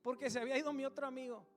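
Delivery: loud, high preaching voice; fiery testimony from the pulpit; preaching at full force. Acted. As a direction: preach the story out to the crowd.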